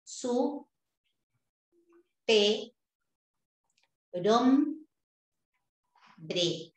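Su, pe, rom, bre with long pauses between; syllable by syllable. Shown as a demonstration. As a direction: break superhombre into slow separate syllables. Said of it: "Mira cómo es".

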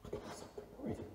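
tense whisper. Real, not acted. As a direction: whisper so nobody hears. Hiding, hears noise.

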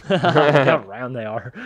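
slow, loud, laughing words. Real, not acted. Watcher delighted by round plushies, laughing. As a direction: gush through a laugh.

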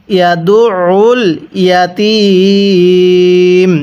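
ইয়াতীম held three alifs long at the pause; three-alif stretch. Shown as a demonstration. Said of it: "ওয়াকফের সময় তিন আলিফ টান দিব". (ইয়াতীম তিন আলিফ টেনে)

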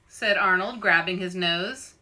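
ordinary delivery

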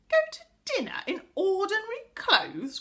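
high, loud scandalised question; horrified cry. A narrator's reading. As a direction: cry out in appalled disbelief. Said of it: "cried Connie".

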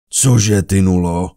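loud, scornful boom; loud contemptuous challenge; thundered. A narrator's reading. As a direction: thunder it back at him. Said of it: "Zahřměl žebrák".

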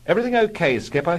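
high, loud, eager chap; Algy rushing forward to check, high and eager; eager airman. Acted. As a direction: chirp up to the skipper.

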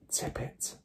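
soft unvoiced whisper; girl whispering to the glass. A narrator's reading. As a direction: breathe the command at the glass. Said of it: "Matilda whispered".

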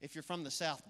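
ordinary delivery

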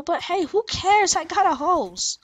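high, slow and cheerful; excited. Real, not acted. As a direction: gloat happily about the horse. Cheering up about the horse.

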